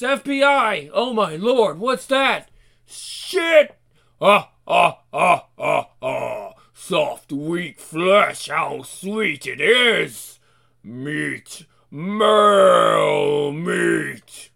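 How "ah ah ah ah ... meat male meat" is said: low, slow, hungry monster voice; hungry demon. Acted. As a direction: savour each word like a beast. Monster relishing flesh.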